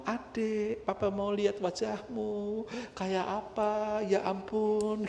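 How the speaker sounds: put-on high, slow cooing to an unborn baby; papa mengajak bicara janin. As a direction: coo dreamily to the baby in the belly, stretching the words. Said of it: "saya sudah ajak ngomong". (suara papa membujuk bayi, tinggi dan pelan)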